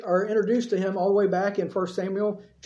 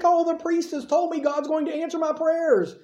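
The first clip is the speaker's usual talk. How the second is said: overjoyed, higher and bright with relief; mock-happy Hannah, pitched up with joy; rejoicing; 7 semitones higher than usual. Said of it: "She goes away rejoicing".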